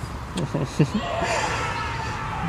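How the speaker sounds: long airy roaring blast through the mouth; a wordless rush of breath for the fire; voiceless dragon roar. Acted. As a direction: roar like a dragon blowing out flame. Dragon roars and blows flames.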